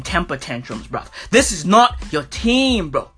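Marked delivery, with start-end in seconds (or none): none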